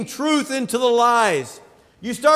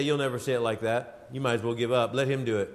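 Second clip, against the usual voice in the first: Satan's taunt; 10 semitones lower, 8 dB quieter, faster than usual. Retold or imitated. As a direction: lower and softer devil voice, discouraging. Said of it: "Satan would say".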